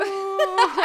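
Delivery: ordinary delivery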